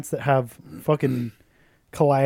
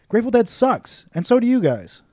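raised, higher, emphatic rant; loud rant; 9 semitones higher, 5 dB louder than usual. Real, not acted. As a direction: belt out the insult. Loud rant against fans.

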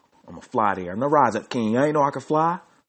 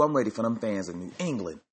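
normal talking voice

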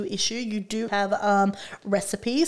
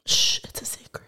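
whispering. Pretend. Mock secrecy, whispering.

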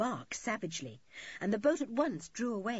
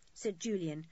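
ordinary delivery, nothing marked

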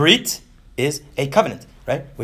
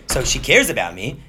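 ordinary talk, nothing marked

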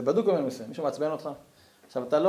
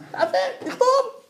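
squeaky laughing aside. Acted.

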